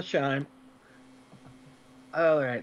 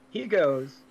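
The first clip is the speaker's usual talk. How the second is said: ordinary talk, nothing marked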